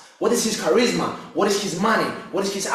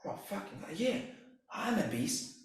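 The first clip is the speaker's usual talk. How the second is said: barely voiced, whispered to himself; hushed self-talk; 10 dB quieter than usual. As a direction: murmur it to yourself as you wake. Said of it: "And I'm like, when I wake up".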